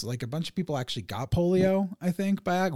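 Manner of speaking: ordinary talk, nothing marked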